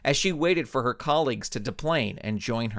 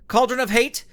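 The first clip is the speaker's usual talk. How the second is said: booming, higher and louder superhero command; 7 semitones higher, 3 dB louder than usual. Acted. Superhero ordering the villains to stop, booming command. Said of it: "Suprema said".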